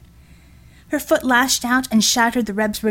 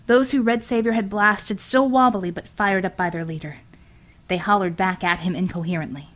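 plain reading voice

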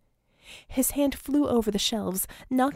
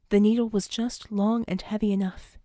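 plain reading voice

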